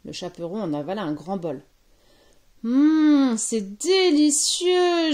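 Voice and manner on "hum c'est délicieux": loud, high, drawn-out relish; big storybook yum; savouring delight. A narrator's reading. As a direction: stretch it out in growing rapture. Girl savours magic soup, transforming.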